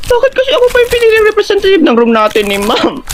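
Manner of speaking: high-pitched hammed-up whine; panicky complaint. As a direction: wail in protest at being chosen. Nervous contestant protests being picked. (nagmamaktol nang mataas ang boses)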